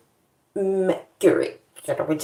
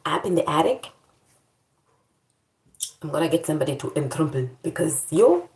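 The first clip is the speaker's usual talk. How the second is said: usual speaking voice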